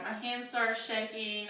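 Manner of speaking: ordinary delivery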